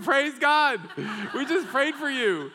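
high, loud, laughing burst of joy; joyful exclamation. Retold or imitated. Answering the call from lost friend, ecstatic laughing shout. Andrew calls right after praying.

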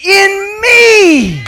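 high, loud declaration; shout. Acted. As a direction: proclaim it. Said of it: "He says".

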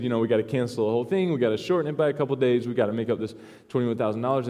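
fast, rushed panicking boss; hurried mock alarm; rushed panic. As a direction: rattle it off in a panic. Panicked pastor's orders.

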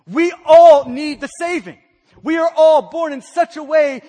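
loud, stress on "all"; urgent shout. Real, not acted. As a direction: press it on everyone. Urgent universal need.

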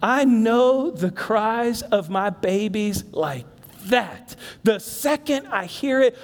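ordinary talk, nothing marked

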